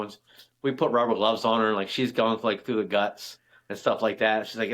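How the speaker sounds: normal talking voice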